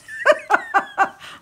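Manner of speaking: short breathy giggle; a fond little real giggle. Real, not acted. Telling the company she misses their sales, giggling.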